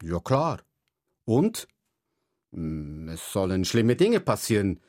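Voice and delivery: put-on lively read-aloud children's story voice; story narration. A narrator's reading. Author reading his book aloud to children, lively narration. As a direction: tell it brightly to young listeners, voicing each kid.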